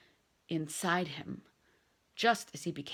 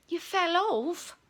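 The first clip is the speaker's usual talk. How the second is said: lightly voiced Fred; 10 semitones higher, 5 dB louder, slower than usual. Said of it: "said Fred".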